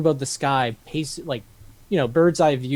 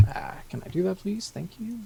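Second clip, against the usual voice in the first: whispered aside; 6 semitones higher, 10 dB quieter than usual. Real, not acted. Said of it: "whispered aside".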